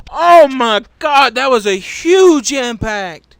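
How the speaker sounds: loud, drawn-out cries of shock; slow, stunned yell at a huge wreck; shocked shouting. Real, not acted. Commentator reacting to a huge crash impact, loud and shocked.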